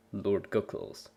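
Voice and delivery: usual speaking voice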